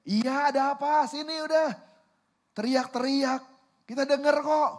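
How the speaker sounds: high, exasperated brother yelling back; annoyed shout back. Acted. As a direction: holler back at a pestering little brother. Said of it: "kakaknya udah sebel banget nih".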